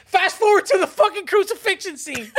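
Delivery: high, hammed-up urging with laughter; mock insistent partner. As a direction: egg them on with comic zeal. Imitates partner insisting on the film.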